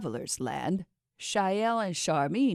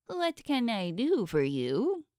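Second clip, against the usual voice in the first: higher, slow would-be regal voice; a god putting on royal airs; pompous mock-royal question; trying to be royal; 6 semitones higher than usual. A narrator's reading. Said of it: "in a trying to be royal tone".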